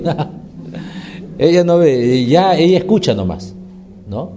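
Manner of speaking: ordinary talk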